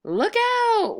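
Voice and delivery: high, slow, drawn-out ghost wail; ghostly wail. Acted. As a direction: wail it down the chimney like a lost spirit. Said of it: "warned the voice".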